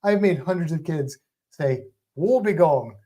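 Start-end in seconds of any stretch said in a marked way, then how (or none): 2.2-3.0 put-on Australian accent. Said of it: "in an Australian accent".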